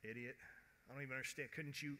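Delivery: soft, muttered contempt; quiet, scornful grumble; dismissive scoff. Acted. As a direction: scoff it under the voice. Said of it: "do you just go".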